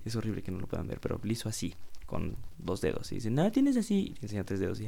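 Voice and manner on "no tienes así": put-on much higher, sing-song baby-talk adult; talking to a toddler. Grown-up coaxing a little kid to answer, high sing-song. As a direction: coo at a small child like a proud grown-up. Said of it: "Es como cuando traes a los niños chiquitos".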